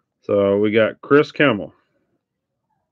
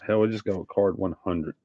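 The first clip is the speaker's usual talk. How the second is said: ordinary delivery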